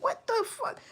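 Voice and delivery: shocked outburst. Retold or imitated. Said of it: "And I'm like".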